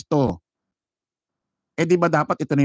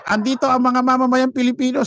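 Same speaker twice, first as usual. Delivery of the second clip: mock rally orator; 9 semitones higher than usual. Vlogger mocking rally speakers' claims, higher mock orator voice. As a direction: ham up a rally speaker's boast. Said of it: "Sino ba nagsabi nun?".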